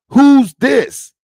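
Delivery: high, loud fake-baffled question; shrill pretend who-are-you; mock surprise. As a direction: act like you've never heard of them. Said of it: "What do I say?".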